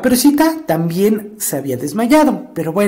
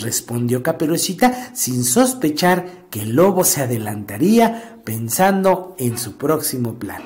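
plain reading voice

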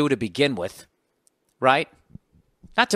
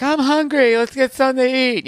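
much higher, louder mock girlfriend whine; 10 semitones higher, 5 dB louder than usual. Comic imitates his girlfriend asking to get food, high and whiny. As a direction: play the hungry girlfriend, higher pitched. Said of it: "My girl's like".

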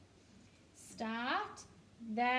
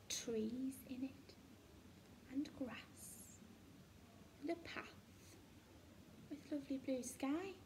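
whispered description; 10 dB quieter than usual. Shown as a demonstration. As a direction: hush it like a secret. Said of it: "whispered picture description".

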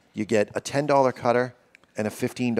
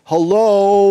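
high, loud mock hello to the ball; greeting the golf ball; 9 semitones higher, 10 dB louder than usual. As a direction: say hello to the ball like it's an old friend. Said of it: "you're gonna address the ball".

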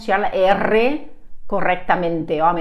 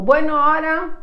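lightly voiced teacher, higher pitch; a hint of a teacher addressing the group; drama teacher; 9 semitones higher than usual. Drama teacher to the circle, lightly imitated bright instruction. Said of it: "la profe dijo".

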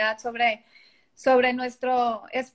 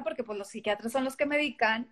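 usual speaking voice

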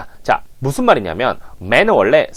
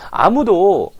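higher, animated reading of the meaning; expressive translation; 11 semitones higher than usual. Shown as a demonstration. Teacher acts out the meaning of the line, animated and higher. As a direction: put feeling into the translated line. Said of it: "이렇게 하는 거죠".

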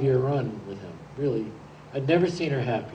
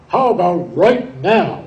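slow, loud booming baritone line; deep baritone voice; 6 semitones higher, 12 dB louder, slower than usual. Retold or imitated. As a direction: lean into it like a smooth older man seizing his chance. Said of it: "And his deep baritone voice".

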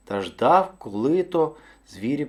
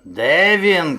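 loud, rising bellowed demand; booming impatient bear; bear shouting; 7 dB louder, much slower than usual. A narrator's reading. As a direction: call out furiously as a hunting bear. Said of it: "кричав ведмідь".

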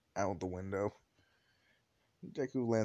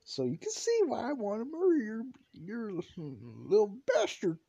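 higher-pitched, seething complaint; acted exasperated anger; 10 semitones higher than usual. Angry boy justifying himself to mom, exasperated. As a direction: drag out the insult at the end.

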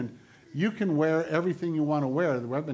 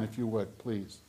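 ordinary talk, nothing marked

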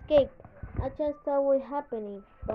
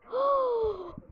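high startled gasp; 9 semitones higher than usual. Real, not acted. Startled again in game.

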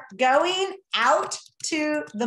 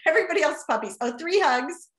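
ordinary delivery

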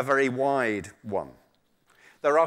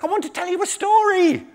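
very high-pitched little creature; small animal voice; 5 dB louder than usual. Acted. Muskrat stalling a hungry tiger, high squeaky voice. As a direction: plead in a tiny high voice. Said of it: "all my small animals have got the same voice".

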